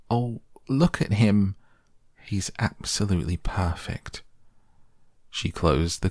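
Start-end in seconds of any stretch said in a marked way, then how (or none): none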